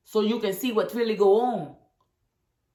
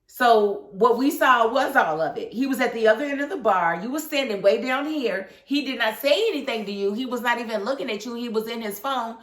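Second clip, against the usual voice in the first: ordinary delivery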